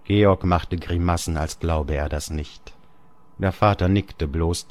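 ordinary delivery

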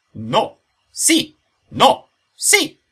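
building from firm to high shouting; escalating quarrel. A narrator's reading. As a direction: trade ever hotter retorts. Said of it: "riscaldandosi sempre di più".

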